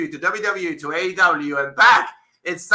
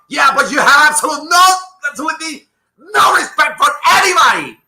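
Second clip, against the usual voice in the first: higher, loud angry shouting; furious rant; 9 semitones higher, 5 dB louder than usual. Real, not acted. Podcaster shouting at the boss, furious. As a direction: tear into him, all fury.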